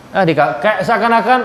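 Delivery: ordinary delivery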